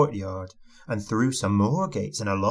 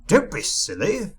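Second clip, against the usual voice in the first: higher-pitched dismissive scoff; scornful snort; 7 semitones higher than usual. A narrator's reading. Rude Mister Uppity dismissing a goblin, scornful. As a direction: scoff it rudely. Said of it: "snorted Mister Uppity".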